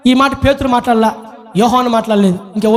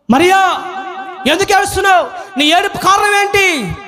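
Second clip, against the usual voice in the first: high, ringing call to a weeping woman; Jesus questioning Mary; 9 semitones higher than usual. Acted. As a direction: call out the question insistently, as if acting it. (ఏడుస్తున్న మరియను ఎలుగెత్తి ప్రశ్నిస్తూ)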